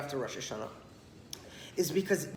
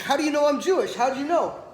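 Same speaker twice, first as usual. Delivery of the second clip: indignant, louder and higher; offended outburst; 7 semitones higher, 6 dB louder than usual. Retold or imitated. Offended man to the rabbi, indignant raised voice. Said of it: "he got very offended".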